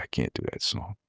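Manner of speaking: soft breathless whisper of the line. Retold or imitated. Admitting to himself he can't do it, whispered. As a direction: breathe it out, barely voiced. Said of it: "I said".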